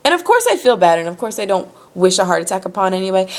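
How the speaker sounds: normal talking voice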